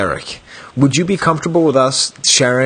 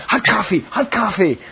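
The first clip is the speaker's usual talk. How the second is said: higher, mock panicked cry; 7 semitones higher than usual. Friend retells Derek's panic, mock panicked. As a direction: imitate Derek running around in a panic. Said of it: "running around going".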